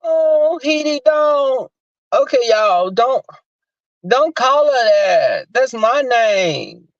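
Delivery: whining upper-register imitation, drawn out; [name]. Imitating her objecting to the name Heidi, high whine. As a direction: plead in a high, slow mock whine. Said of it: "this whining voice that [name] goes into that upper register".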